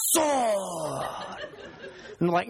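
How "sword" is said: long, falling mock battle cry; Beckett drawing his sword. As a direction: imitate a kid's drawn-out sword-draw noise. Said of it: "pull out his carrot and go".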